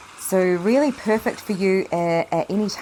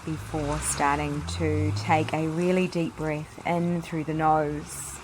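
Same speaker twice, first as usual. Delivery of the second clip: soft, unhurried meditation voice with long pauses; guided meditation; 7 dB quieter than usual. Acted. Leads a guided yoga meditation.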